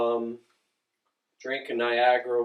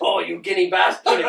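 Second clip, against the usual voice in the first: higher, louder mock insult; drunk Irish guy; 4 semitones higher, 5 dB louder than usual. Drunk Irish guy ribbing a Staten Islander, louder mock insult. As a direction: imitate an Irish drunk, laugh close behind. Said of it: "there was a drunk Irish guy running around".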